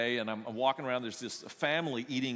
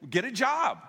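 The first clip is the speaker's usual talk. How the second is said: higher and louder, a mock bark; blunt retort; 9 semitones higher, 6 dB louder than usual. To the young guy, a loud blunt mock order. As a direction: snap it out like blunt advice. Pastor bluntly tells guest off.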